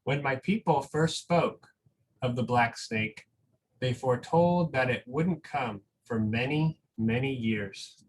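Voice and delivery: slow, gentle storybook reading with long pauses; reading a picture book aloud. Acted. Teacher reading a picture book to school families, slow and gentle.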